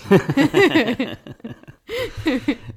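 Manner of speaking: big high burst of laughter; loud laugh. Real, not acted. Laughs at joke ending.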